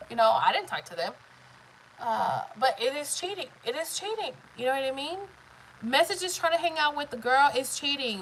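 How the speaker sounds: ordinary talk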